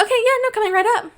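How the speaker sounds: high-pitched put-on service voice; chirpy barista imitation. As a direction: mimic an over-eager barista up high. Retells a barista's chirpy reply.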